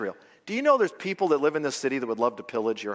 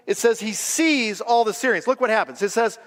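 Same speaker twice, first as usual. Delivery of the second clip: normal talking voice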